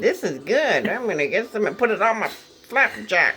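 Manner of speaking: hint of a goofy kid. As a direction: play an eager kid finding syrup. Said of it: "twelve-year-old ... licked it and said".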